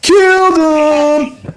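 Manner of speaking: very high, slow squeal; mimicking her exclamation. Host imitates a caller's catchphrase in a high squeal. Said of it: "where she went, "Killed him".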